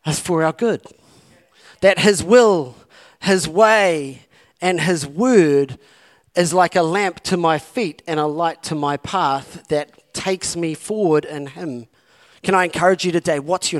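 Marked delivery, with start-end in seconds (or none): none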